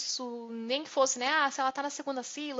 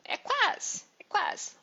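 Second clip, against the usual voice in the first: playful tease; 8 semitones higher, slower than usual. Acted. Teasing quiz answer.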